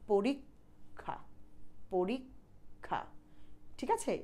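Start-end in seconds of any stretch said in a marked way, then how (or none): 0.1-3.0 পরীক্ষা very slowly, split as porik, then kha; পরীক্ষা drawn out in two separated parts; half-pronounced ক. Shown as a demonstration. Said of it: "প্রথম কটা অর্ধেক".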